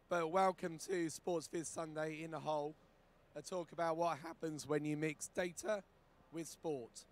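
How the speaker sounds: ordinary delivery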